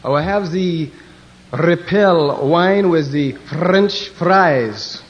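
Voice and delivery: slow, exaggerated French accent. Frenchman ordering from stewardess, slow mock French accent. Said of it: "Then he asked the Frenchman".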